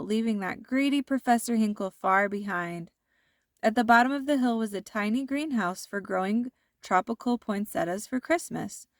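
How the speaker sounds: ordinary delivery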